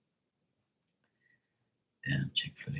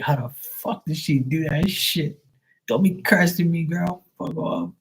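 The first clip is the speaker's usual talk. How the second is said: higher, mock-indignant protest; mock outraged self; 9 semitones higher than usual. [name] protesting Sue jinxed his cards, higher mock outrage. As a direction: act out your own exasperation, pitched up. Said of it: "And I'll be like".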